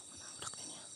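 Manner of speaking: hushed unvoiced whisper. Real, not acted. Vlogger sneaking through dark forest looking for kids, whisper. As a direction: whisper so nothing hears you.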